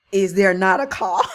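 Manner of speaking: shouted scripture question; loud, emphatic comeback; loud protest. Acted. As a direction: belt it out as an indignant challenge. Acting David's protest from scripture.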